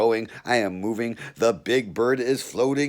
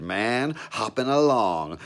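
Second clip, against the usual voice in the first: plain reading voice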